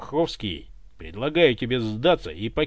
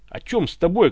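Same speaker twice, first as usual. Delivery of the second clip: usual speaking voice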